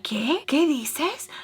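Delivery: a put-out stepdad asking what?; annoyed. Retold or imitated. Stepdad on the phone, annoyed and confused. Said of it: "Like, all annoyed".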